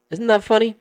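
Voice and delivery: high, loud odd voice; creepy question. Retold or imitated. Staring homeowner asking the salesman an odd question, high and loud. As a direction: ask it in a strange high pitch.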